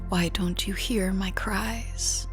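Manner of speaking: plain reading voice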